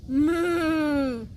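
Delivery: long, drawn-out moo; cow mooing. Acted. Cow answers the kitten, long moo. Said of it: "said the cow".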